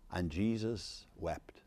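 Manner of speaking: slow, near-whispered scripture reading; hushed solemn quote. Retold or imitated. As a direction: let the verse drop to a slow hush. Solemn quote of shortest verse.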